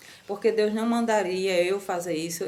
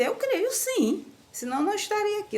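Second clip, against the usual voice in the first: landlady's earnest reply; 12 semitones higher than usual. Retold or imitated.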